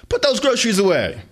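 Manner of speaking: loud, high barked command; mother snapping at her son; stern order. Acted. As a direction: order the kid around.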